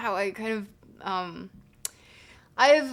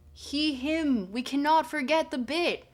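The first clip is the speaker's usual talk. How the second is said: higher, louder grand proclamation, slowing at the end; dramatic orator; 6 semitones higher, 6 dB louder than usual. Acted. Streamer declares to chat that the bit must live, grandly. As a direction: declaim it like a mock philosopher.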